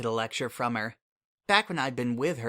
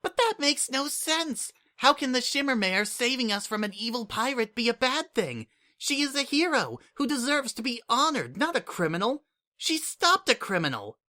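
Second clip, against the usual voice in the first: put-on high childlike voice, protesting hard; a small kid outraged on her hero's behalf; indignant little changeling; 12 semitones higher than usual. Kukri defending Sunset, indignant, high childlike voice. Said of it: "outright scowl".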